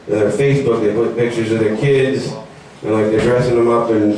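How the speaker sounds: ordinary delivery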